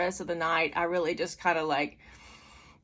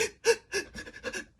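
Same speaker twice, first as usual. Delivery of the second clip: high, voiceless gasping spasms; short involuntary-sounding gasps pulled from the chest; reenacted heaving breaths; imitated heaving gasps; 4 dB quieter than usual. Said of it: "It was like".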